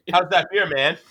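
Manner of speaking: put-on high, loud Canadian-accented greeting; hammed-up Canadian lilt; tipsy Canadian accent. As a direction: call it out in a chummy Canadian accent. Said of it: "I'm like this".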